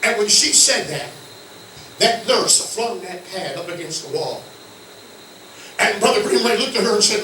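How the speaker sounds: usual speaking voice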